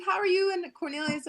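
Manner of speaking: high Jamaican mom voice, imitating; lightly done Jamaican accent; mom's Jamaican accent. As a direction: imitate a Jamaican mother's greeting. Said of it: "And she's like".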